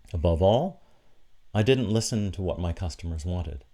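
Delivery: ordinary talk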